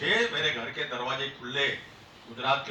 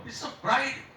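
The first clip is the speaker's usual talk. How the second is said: usual speaking voice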